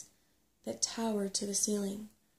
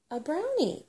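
high, louder impatient repeat; testy, raised-pitch insistence; 8 semitones higher, 5 dB louder than usual. A narrator's reading. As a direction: repeat it with exasperation. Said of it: "repeated Sorrel impatiently".

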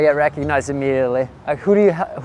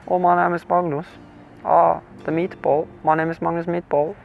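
hammed-up German-sounding Norwegian accent, said softly; mock German accent; 3 dB quieter than usual. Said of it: "almost like a German accent".

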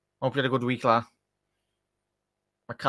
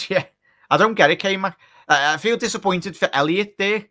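higher, louder, worked-up reply; excited; 8 semitones higher, 5 dB louder than usual. Real, not acted. Fan answering viewer K-Mac about subs, higher and louder. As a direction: get animated answering K-Mac.

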